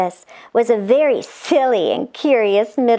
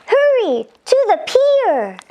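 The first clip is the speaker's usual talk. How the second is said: much higher excited call; urgent call; 10 semitones higher than usual. Acted. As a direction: hurry them along. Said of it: "and said".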